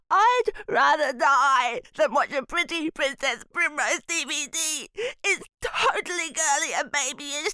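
put-on high, pleading panicky child; distraught boy. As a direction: beg in desperate horror. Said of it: "in a distraught voice".

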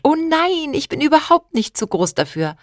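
very high "nein" falling off; girl begs her uncle in a high, excited voice; excited plea. Acted. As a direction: squeal "nein" high then drop it. Child protests she's not too big.

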